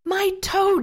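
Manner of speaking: high, loud pained yell. A narrator's reading. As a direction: bellow it out in pain. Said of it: "Jeffy yelled".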